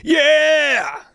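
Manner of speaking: very high gritty rock yell; halfway to a scream, with crunch in the throat. Shown as a demonstration. Singer shows off a new gritty tone, gritty yell. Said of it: "get this gritty tone now".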